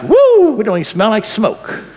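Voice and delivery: high shouted woo, then a grin; joyful whoop. Retold or imitated. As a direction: cheer like you just walked out of the fire. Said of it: "You're like".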